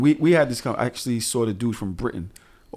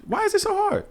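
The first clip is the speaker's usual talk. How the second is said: much higher, comic despair; exasperated; 12 semitones higher than usual. Retold or imitated. Dad exasperated with kids at bedtime, mock despair. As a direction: plead to the heavens. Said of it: "I'm like".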